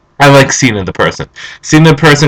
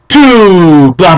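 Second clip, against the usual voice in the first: drawn-out promo filler, pitched up; The Rock; 5 semitones higher, 3 dB louder than usual. Acted. Fan mimicking The Rock's entrance line, hammed-up promo. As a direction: stretch it like a wrestler's catchphrase. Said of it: "he would say".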